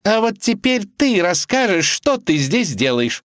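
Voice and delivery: high, triumphant demand; gloating. Acted. Vasilisa turns on the wolf for an explanation, gloating. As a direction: corner him with malicious glee. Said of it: "злорадно воскликнула она".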